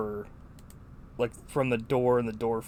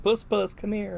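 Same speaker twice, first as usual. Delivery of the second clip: higher, lightly voiced old cartoon calling a cat; mock cartoon call; 8 semitones higher than usual. Mimicking old cartoons for a friend, a hint of sing-song cat calling. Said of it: "older cartoons where they're like".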